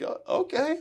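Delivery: a comic double take, caught off guard; surprised okay. Retold or imitated. Reacting to the coach's challenge.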